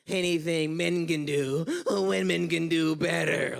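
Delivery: slow, stretched put-on voice; imitating a sloganeering new age feminist; mock feminist. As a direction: drag out the slogan in mockery. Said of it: "one of these new age feminists".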